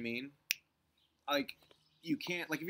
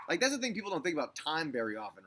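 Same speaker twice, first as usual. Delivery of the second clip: ordinary talk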